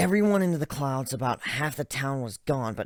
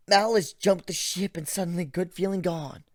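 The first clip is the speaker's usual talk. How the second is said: usual speaking voice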